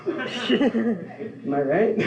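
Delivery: laughing through a slow "Am I right?". Real, not acted. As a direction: chuckle it out to the crowd. Punchline tag to audience.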